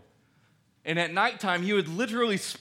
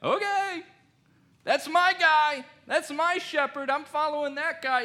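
high, eager sheep voice; excited sheep; 10 semitones higher, 4 dB louder than usual. Retold or imitated. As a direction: squeal it like a thrilled sheep. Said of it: "And they'll be like".

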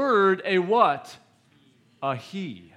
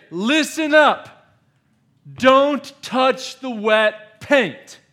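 much higher and louder, slow shouted warning; raised voice; 9 semitones higher, 10 dB louder, much slower than usual. Shown as a demonstration. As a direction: shout it slowly like a warning sign. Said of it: "You can raise your voice".

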